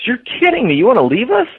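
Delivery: imitating the boss's shock at the news; high, incredulous boss; shocked disbelief. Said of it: "looked at me and goes".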